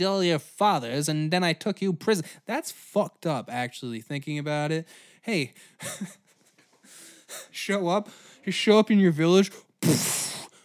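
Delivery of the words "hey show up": ordinary talk, nothing marked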